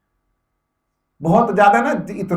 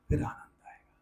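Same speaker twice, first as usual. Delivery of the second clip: hushed, softer voice; whisper; 11 dB quieter than usual. Real, not acted. Teacher whispering to meditators what joy awaits them. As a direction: breathe the promise of bliss quietly. Teacher softly promising bliss.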